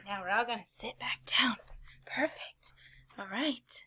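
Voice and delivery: soft hushed whisper. Acted. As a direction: hush them down gently. Calming kids after sitting down.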